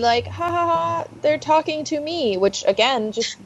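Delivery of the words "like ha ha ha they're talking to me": mocking laugh. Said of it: "They're like".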